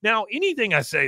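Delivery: high-pitched aggrieved rant; wailing lament. Retold or imitated. Con laments lost bragging rights.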